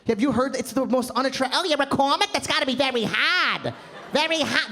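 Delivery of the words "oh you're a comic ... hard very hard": higher, grating mock South Boston accent. South Boston date talks to a comic, Boston accent. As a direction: exaggerate an unattractive Boston accent. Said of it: "That Boston accent".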